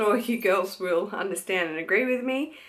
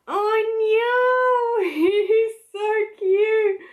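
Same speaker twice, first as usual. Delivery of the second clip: much higher and louder, squealing with laughter; emotional squeal; 14 semitones higher, 7 dB louder, much slower than usual. Real, not acted. To viewers showing her baby scan, emotional squeal.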